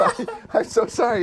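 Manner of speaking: high-pitched apology breaking into laughter; hammed-up pleading sorry; mock apology. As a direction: play a flustered apology, half laughing. Acting actor apologizing to wife.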